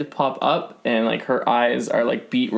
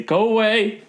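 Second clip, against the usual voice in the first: higher, frightened shopkeeper's cry; hiding woman telling the cleric to leave, higher; hidden woman; 10 semitones higher than usual. Acted. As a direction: push the stranger away from under the counter.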